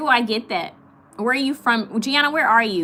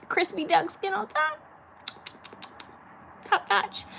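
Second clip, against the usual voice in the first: dreamy food praise; 11 semitones higher, 6 dB quieter than usual. Real, not acted. Savoring the food memory.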